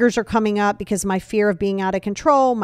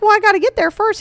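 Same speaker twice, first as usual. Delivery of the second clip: pitched way up, a louder indignant squeak; high protest; 11 semitones higher, 5 dB louder, faster than usual. Retold or imitated. To the pilot, nervous flyer protesting in a high indignant squeak.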